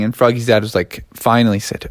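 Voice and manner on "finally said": ordinary delivery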